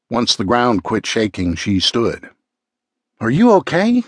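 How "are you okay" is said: concerned. Acted. Checking on her after the quake.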